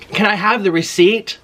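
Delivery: high, rising polite request; overly polite, sing-song request; sweet polite ask. Retold or imitated. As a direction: ask nicely with a lift at the end. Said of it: "I said it like that".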